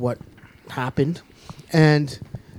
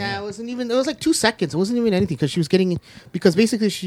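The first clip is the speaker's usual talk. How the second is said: higher-pitched flustered protest; upset, defensive; 6 semitones higher than usual. Real, not acted. Fan downplaying the topless scene, upset and defensive. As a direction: protest too much, pitch riding high. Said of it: "You sound is upset about that".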